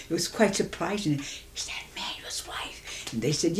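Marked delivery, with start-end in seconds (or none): 1.6-3.1 hushed gossiping whisper, slow; whispered question. Retold or imitated. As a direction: lean in and whisper it behind her back. Onlookers whisper about new bride.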